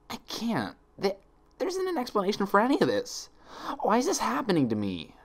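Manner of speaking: high, strained plea to the world; desperate demand. A narrator's reading. Twilight daring the world to answer, desperate high cry. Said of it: "Twilight demanded".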